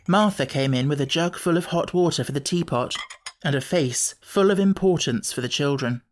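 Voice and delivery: ordinary delivery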